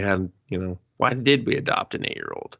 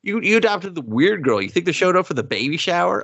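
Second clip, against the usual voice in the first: high-pitched, incredulous questioning; mock scornful rant; 9 semitones higher than usual. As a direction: scoff in a hammed-up complaint. Acted scornful rant at the parents.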